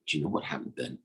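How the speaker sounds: ordinary delivery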